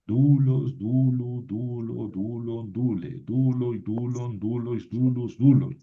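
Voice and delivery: DU-los, DU-lu, DU-lo in an even chant; rhythmic drill with each "duloi" form stressed on DU; penultimate stress. Shown as a demonstration. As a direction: recite "Dulos, dulu" steadily, beat always on "du". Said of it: "siempre va ahí sobre la penúltima".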